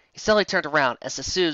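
plain reading voice

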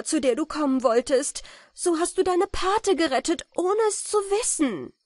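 high, loud, overjoyed outburst; joyful recognition. A narrator's reading. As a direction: proclaim the discovery with rising emotion. Countess joyfully recognises her godson.

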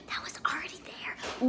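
a sly unvoiced aside; whispered aside. Real, not acted. Whispered aside to the viewers after a joke spoiler. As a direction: whisper it under the breath.

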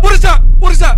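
frantic high shouting; panicked yelling. Real, not acted. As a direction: yell it in sudden panic. Panicked at sudden sound.

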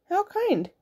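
put-on high boy voice. Jack thanks the wolf.

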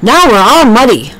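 high, lively farmhand voice; a cheerful farmhand, exasperated; a farmhand fussing over the mud. A narrator's reading. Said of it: "the farmhand said".

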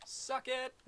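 high, loud gleeful jeer; gloating taunt. Real, not acted. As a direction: crow over the kill. Taunt after blinding enemies.

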